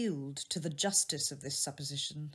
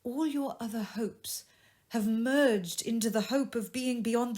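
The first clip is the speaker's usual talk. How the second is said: a lightly voiced young woman, a bit higher and sorrowful; lightly raised, grieving young woman; young woman's voice; 5 semitones higher, 7 dB louder than usual. A narrator's reading. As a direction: speak as the jilted sweetheart, hurt but composed. Belle voiced by narrator.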